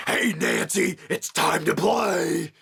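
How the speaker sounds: slow, creepy horror-villain taunt; Freddy Krueger. Retold or imitated. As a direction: drag out the invitation like a nightmare killer. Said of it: "That was my Freddy Krueger impression".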